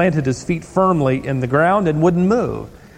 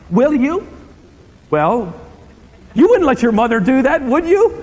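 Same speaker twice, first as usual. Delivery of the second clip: incredulous challenge; 6 semitones higher than usual. Real, not acted. Preacher challenging the congregation.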